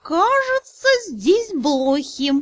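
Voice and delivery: high then slow, bewildered; puzzled spirit noticing fleas; confused dragon spirit. Acted. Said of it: "растерянно произнёс".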